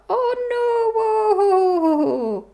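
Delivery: high, loud, drawn-out wail; comic long wobbling cry of dread; mock wail. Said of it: "Which was a bit of a".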